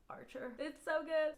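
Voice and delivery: lovesick, hushed and high; dreamy swoon. Real, not acted. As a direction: say his name like you're melting. Swooning over the book's hero.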